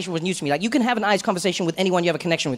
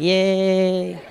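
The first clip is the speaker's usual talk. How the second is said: sarcastic yay. Retold or imitated. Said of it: "I'm like".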